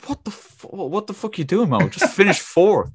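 high-pitched, mock-baffled retelling; retold bewilderment. Runner to the friend who jumped on him, retold bewilderment.

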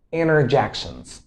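interjections with the T dropped, "innerjections"; American reduced T. Shown as a demonstration. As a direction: say interjections as "innerjections", falling at the end. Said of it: "You can pronounce it this way".

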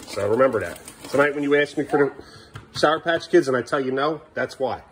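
normal talking voice

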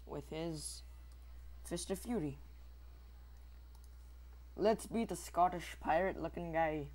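ordinary delivery

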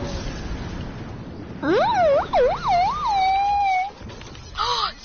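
long high-pitched gasping squeal; drawn-out, very high gasp; shocked gasp. Real, not acted. Gasps in fright during game.

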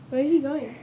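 normal talking voice

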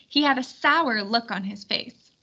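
ordinary delivery, nothing marked

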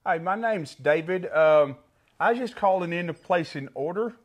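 put-on prank caller faking a country accent. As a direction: play a country dad phoning in an order. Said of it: "gotta talk in a country accent".

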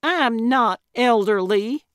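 high, loud protest; indignant cry. A narrator's reading. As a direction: cry out, offended. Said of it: "Gertrude cried".

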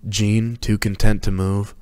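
usual speaking voice

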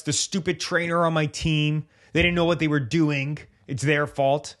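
light put-on gripe at the trainer; mock angry blame. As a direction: athlete venting at the trainer, mildly mock angry. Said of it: "they get angry".

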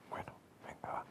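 susurrado, resignado; susurro. Real, not acted. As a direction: casi sin voz, para sí mismo. Susurra resignado ante el GPS. (susurrando, resignado)

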